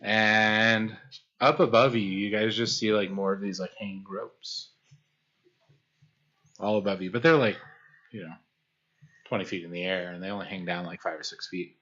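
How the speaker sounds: calm scene-setting narration; game master narration. Acted. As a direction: paint the cave for the players. GM describes the cavern.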